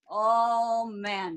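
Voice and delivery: ordinary delivery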